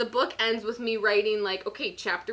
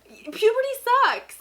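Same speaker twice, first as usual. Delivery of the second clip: exclaiming; 11 semitones higher, 6 dB louder than usual. Real, not acted. Exclaiming about puberty.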